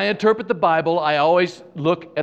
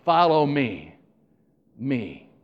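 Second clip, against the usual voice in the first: "me" set apart and repeated lower; stress on ME; 3 semitones lower, slower than usual. Acted. Jesus simply inviting people to follow, stressing "me". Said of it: "He's just simply saying".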